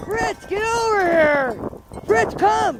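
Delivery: high, carrying call to the dogs. Real, not acted. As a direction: call the dogs from across the yard. Calling dogs across snowy yard.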